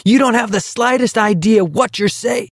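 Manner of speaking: high, furious yell. A narrator's reading. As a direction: yell at him in anger. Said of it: "yelled at him furiously".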